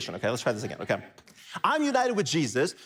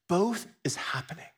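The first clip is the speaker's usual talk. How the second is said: whisper. Real, not acted. Sudden whispered emphasis.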